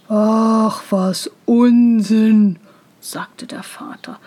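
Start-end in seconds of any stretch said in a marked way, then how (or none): 0.1-2.6 slow, weighty father voice, well projected; dismissive father. A narrator's reading. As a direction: wave it away with slow disdain.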